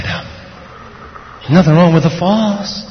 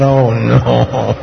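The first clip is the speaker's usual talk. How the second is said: low, drawn-out mock groan; weary dread; 4 semitones lower than usual. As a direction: groan it like a tired dad. Tired dad dreads playing.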